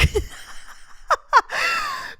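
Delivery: high, drawn-out laugh; real high-pitched giggling fit. Real, not acted. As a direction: crack up at your own story. Laughs at own retold quote.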